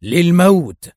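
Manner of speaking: high, loud exclamation; crying out. A narrator's reading. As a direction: cry it out high and forceful. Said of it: "تهتف".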